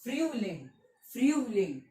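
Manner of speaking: फ्यूलिंग said twice as "FRYU-ling"; falling German model of फ्यूलिंग, pause between takes; German pronunciation. Shown as a demonstration. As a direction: say फ्यूलिंग with a rounded umlaut "yu". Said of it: "spring को हम क्या कहते".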